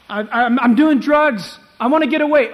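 loud, high cry of confession; acted desperate confession. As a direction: call it out as a troubled sinner. Voices a sinner's confession.

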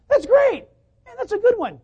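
high, loud burst of praise; enthusiastic coworkers. Acted. Coworkers praising a job well done, high and excited. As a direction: imitate coworkers cheering good work. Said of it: "the coworkers are going".

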